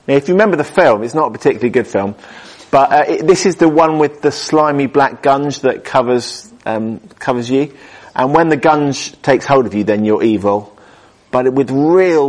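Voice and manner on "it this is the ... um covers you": ordinary talk, nothing marked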